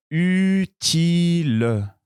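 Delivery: utile pulled out very slowly and high; very slow model word. Shown as a demonstration. Teacher dictating first word, very slow. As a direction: stretch utile even longer. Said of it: "Je commence le premier mot".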